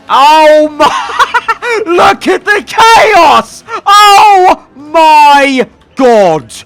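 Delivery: high, loud, slow cries broken by laughter; real delighted disbelief at the pile-up; laughing shout. Real, not acted. Huge crash chaos on track.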